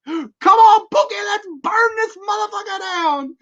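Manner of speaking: very high, loud rallying cry; rowdy movie yell. Retold or imitated. Quoting the film, urging a pal with a very high loud yell. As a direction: fire up your buddy, all in.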